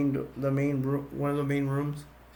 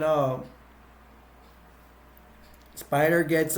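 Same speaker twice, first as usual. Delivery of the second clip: ordinary delivery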